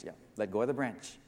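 low, soft, unhurried God voice; matter-of-fact quiet reply; calm confirmation. Acted. God calmly repeats the instruction.

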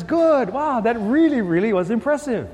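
lightly imitated admiring host; Merv Griffin. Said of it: "And Merv says".

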